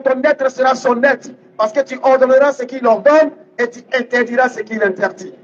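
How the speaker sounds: ordinary talk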